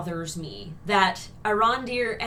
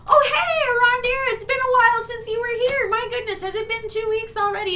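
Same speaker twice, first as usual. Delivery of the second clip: very high, chirpy greeting, loud at the start; hammed-up delighted welcome in a squeaky high voice; mock friendly villagers; 12 semitones higher, 5 dB louder than usual. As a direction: gush like villagers greeting an old regular. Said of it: "everybody's like".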